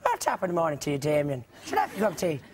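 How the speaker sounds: a light stage-Irish lilt; mild mock Irish. Joking at Damien in a light mock Irish accent. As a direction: tease an Irishman over morning tea. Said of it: "the obvious thing to say is".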